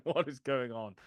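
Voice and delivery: normal talking voice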